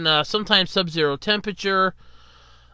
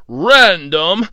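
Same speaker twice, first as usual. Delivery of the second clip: announcer voice; 9 dB louder than usual. Acted. Mock show intro announcer.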